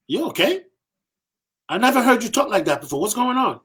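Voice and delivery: high-pitched, taken-aback questioning; puzzled concern. Retold or imitated. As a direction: imitate a boss thrown by unexpected swearing. Boss reacts to his swearing.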